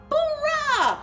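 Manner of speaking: plain reading voice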